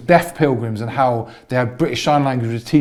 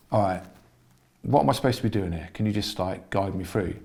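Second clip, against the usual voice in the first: soft, low retold appeal for guidance; humble inner prayer, softly spoken; quiet private plea; 6 semitones lower, 11 dB quieter than usual. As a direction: ask it privately, low and hushed.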